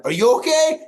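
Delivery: high, loud mock-panicked question; panicked retort. Son-in-law firing the question back after the quake, panicked. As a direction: blurt it back, pitch shooting up. Retelling his frantic reply to her.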